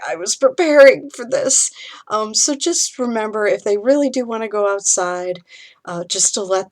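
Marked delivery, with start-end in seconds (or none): 0.1-1.7 louder, higher, half-laughing wounded protest; exasperated outburst. Retold or imitated. Parent blurts out hurt at the child's shrug, loud and higher. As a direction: play the heartbroken parent for laughs.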